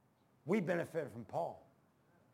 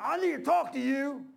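loud, high, drawn-out demand; confrontational holler; 7 semitones higher, 6 dB louder than usual. Retold or imitated. Old man demands a word with the preacher mid-service, loud holler. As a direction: imitate an old man hollering slowly across a room.

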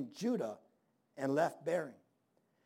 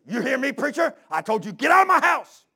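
louder, higher threatening shout at the preacher; angry yell; 7 semitones higher, 10 dB louder than usual. Retold or imitated. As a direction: bellow it like an angry farmer. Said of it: "And he goes".

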